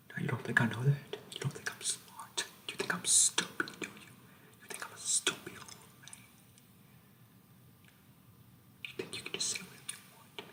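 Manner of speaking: menacing drill sergeant in a soft whisper; creepy whispering sergeant. Acted.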